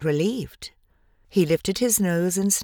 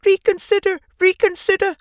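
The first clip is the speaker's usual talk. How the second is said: much higher, louder goose cry; 13 semitones higher, 5 dB louder than usual. A narrator's reading. Goose crying after Wilbur, much higher and louder. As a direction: cry out shrilly like a desperate goose. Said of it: "cried the goose".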